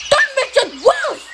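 very high-pitched, outraged retort; indignant retort. A narrator's reading. Policeman answers Thomas's driver, indignant and high. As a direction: squawk it back, officious and cross.

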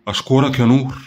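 plain reading voice

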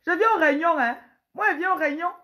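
high, loud bragging husband; boastful husband. Acted. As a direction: boast about the meetings up high. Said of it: "Un pauvre autoritaire". (mari vantard qui parle fort)

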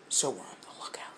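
hushed, expectant whisper. Real, not acted. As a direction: breathe it while watching. Waiting quietly for first light.

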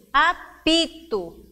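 "Apito" slow and loud, in beats; firm separated syllables in "Apito". Shown as a demonstration. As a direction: break "Apito" into a, pi, to. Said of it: "três sílabas".

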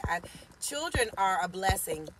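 ordinary delivery

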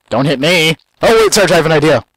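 loud, high, eager shouting; excited yell. Real, not acted. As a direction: yell it out, thrilled. Excited yelling in game.

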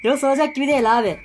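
usual speaking voice

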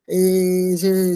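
ordinary delivery

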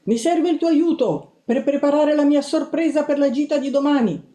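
high, loud, urgent sister voice; bossy sister shrilling down the phone; Tea. A narrator's reading. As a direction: plead excitedly in a squealing high pitch. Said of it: "strillò mia sorella Tea".

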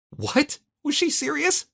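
very high-pitched disbelief; astonished high-voiced question; incredulous. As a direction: squeak it out, unable to believe it. Narrator reacts in disbelief to scolding.

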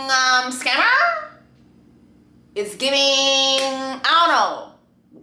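slow, stretched hesitating phrases; drawn-out searching. Real, not acted. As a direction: drag it out searching for words.

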